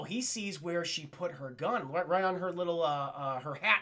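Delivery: ordinary talk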